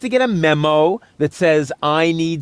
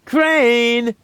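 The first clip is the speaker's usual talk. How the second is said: calling out; 5 semitones higher than usual. Acted. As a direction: shout across the room to Crane. Calling out for Crane.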